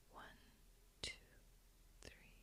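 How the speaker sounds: barely audible, spaced-out counting; hushed breath count. Acted. Guide counts a listener's inhale, very hushed. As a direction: count very faintly, leaving room to breathe.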